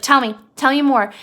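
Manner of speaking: loud, mildly eager prompting; Lenny urging. Retold or imitated. Lenny wanting George to carry on, loud mock urging. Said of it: "And Lenny's like".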